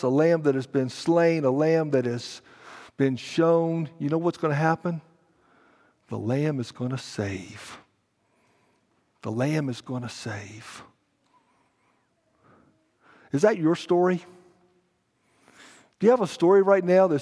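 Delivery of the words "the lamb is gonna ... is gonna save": low, soft, solemn statement; hushed assurance. Real, not acted. Preacher tells congregation the lamb will save, hushed. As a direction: drop right down and let it land quietly. Hushed answer to own question.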